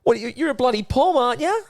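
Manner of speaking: very high, teasing accusation; mock-accusing Aussie. Aussie calling out the Pom, very high mock accusation. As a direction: imitate an Aussie ribbing a Brit, voice shooting up.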